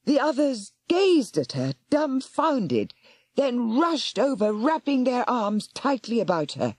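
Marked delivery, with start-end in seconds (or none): none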